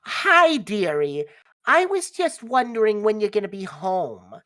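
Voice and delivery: high, loud motherly chirp; dearie-calling mother. Acted. Mother phoning her child about coming home, high doting voice. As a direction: sing out brightly as a doting mom. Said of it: "your mother's on the other end".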